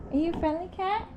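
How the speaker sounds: high soft coaxing question. Real, not acted. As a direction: coo at the kitty. Cooing at a cat.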